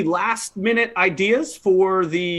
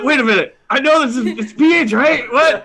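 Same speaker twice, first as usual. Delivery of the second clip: higher, louder, baffled self-talk; flustered thought; 6 semitones higher, 5 dB louder than usual. Retold or imitated. Teller replays forgetting how to spell phenomenal, flustered. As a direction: act out sudden confusion over spelling.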